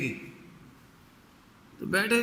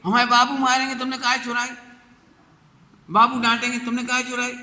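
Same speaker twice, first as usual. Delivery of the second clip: put-on higher, louder childlike scold; loud scolding girl; 8 semitones higher, 5 dB louder than usual. Girl scolding the thief about her father, loud high scold. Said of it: "जोर से बोल रही थी".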